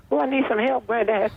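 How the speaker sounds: a light take on a grandson begging for help; imitating grandson. Grandson pleading on the phone.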